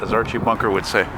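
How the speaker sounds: ordinary talk, nothing marked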